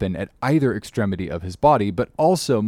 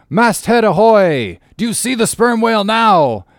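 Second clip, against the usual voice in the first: hailing the masthead; 12 semitones higher, 8 dB louder than usual. Acted. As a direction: call up to the lookout. Captain hails the masthead.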